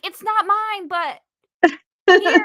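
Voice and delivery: own retold reply. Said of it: "I'm like".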